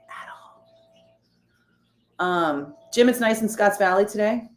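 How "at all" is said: faint, whispered afterthought. Real, not acted. Host who can't with those people, whispering. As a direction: let it die away to a whisper.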